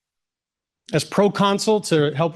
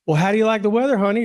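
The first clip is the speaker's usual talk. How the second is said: imitating a sweet, higher Texas drawl, a bit louder; 7 semitones higher, 4 dB louder, much faster than usual. Texas bank woman greeting sweaty newcomers, light imitated Texas drawl. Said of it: "a just beautiful Texas drawl".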